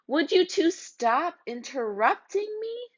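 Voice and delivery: high, irritated scolding; exasperated scold. Acted. Narrator scolds the two interrupting kids, exasperated. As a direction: snap at the interrupters.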